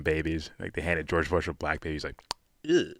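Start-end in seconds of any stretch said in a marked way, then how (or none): 2.6-2.9 quick high-pitched "ew", playing disgusted; mock disgust. Acting out a politician handed a baby, mock disgust. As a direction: recoil with a high little mock ew.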